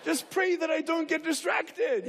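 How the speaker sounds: high-pitched playing along; string them along in a high voice; playful, high put-on assurance; mock-serious bluff. Pretend pilot plays along.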